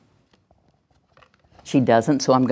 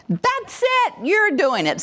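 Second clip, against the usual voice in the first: high, bright praise as she moves; encouraging praise; 14 semitones higher, slower than usual. Shown as a demonstration. Parent praising the child the moment she moves. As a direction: cheer her on the moment she starts. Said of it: "you're gonna go".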